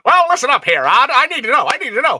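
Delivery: put-on high, loud old radio announcer; old-timey radio voice. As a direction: ham it up like a 1930s radio host. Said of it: "the old-timey radio voice".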